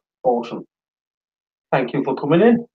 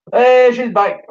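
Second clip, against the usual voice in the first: excited greeting; 6 semitones higher, 6 dB louder, slower than usual. Real, not acted. Greeting wife's return.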